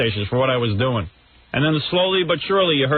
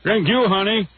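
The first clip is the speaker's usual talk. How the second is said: lightly mocked radio host, higher; raised host voice thanking the reporter; Imus; 5 semitones higher than usual. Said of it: "I'll do an impression of Imus".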